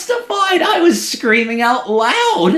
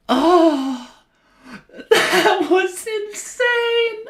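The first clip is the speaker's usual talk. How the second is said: out of breath; 12 semitones higher, much slower than usual. Real, not acted. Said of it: "I am so out of breath after that".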